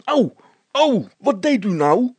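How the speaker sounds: high, loud yelps of pain; shrieking in pain. Acted. Policeman shrieks as someone checks his legs, loud yelps. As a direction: shriek it out, hurt and startled. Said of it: "krijschte de veldwachter".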